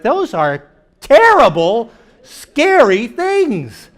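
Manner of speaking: normal talking voice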